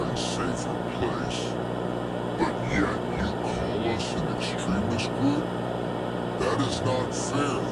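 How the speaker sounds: the group taunts the government in a garbled voice; distorted villain broadcast. Acted. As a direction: menace through a broken-up electronic voice. Extremist group answers the government.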